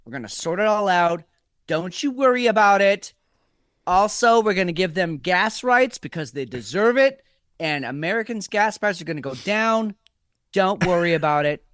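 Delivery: hammed-up Trump bragging and reassuring; mock president promising a Mar-a-Lago weekend; Trump impression. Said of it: "this is President Trump".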